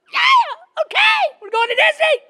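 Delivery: mimicked toddlers shrieking along, very high; excited little kids. Small sons cheering along without understanding, high mimicked shrieks. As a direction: squeal it loudly like small boys copying dad. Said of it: "they don't know what the heck is going on".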